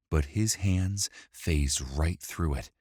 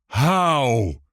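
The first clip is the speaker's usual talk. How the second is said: loud, high, drawn-out yell; screamed question; 9 semitones higher, 8 dB louder than usual. A narrator's reading. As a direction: yell it out as a desperate demand. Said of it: "Griff screamed".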